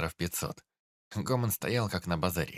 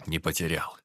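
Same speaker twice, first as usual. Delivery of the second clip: hoarse voice. Acted. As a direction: say it hoarsely, worn out. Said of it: "хрипло сказал он".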